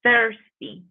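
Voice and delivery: "Thirsty" said carefully as a teacher's model; "Thirsty" as a careful standalone pronunciation example; pronunciation demo. Shown as a demonstration. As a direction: model the word "Thirsty" clearly for learners, falling at the end. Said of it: "Pendiente a la pronunciación de esta palabra".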